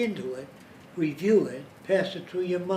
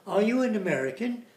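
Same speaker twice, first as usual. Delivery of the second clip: put-on light English accent, sing-song rise, higher; a hint of sing-song English asking; 5 semitones higher than usual. Lecturer imitating an Englishman's sing-song, light English accent. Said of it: "with a certain sing-song".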